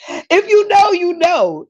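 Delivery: high, excited sing-out; gleeful insider. Real, not acted. Telling viewers about growing up in Brooklyn, high and gleeful. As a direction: pitch it way up for those in the know.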